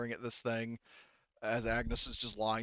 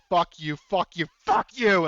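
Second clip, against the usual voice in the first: loud shrieking curses, voice climbing; 6 semitones higher, 11 dB louder than usual. Retold or imitated. GM voicing the character shrieking at the monster in rage. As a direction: scream it at the creature in berserk rage. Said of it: "she's shrieking".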